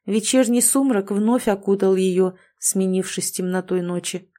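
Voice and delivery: ordinary delivery, nothing marked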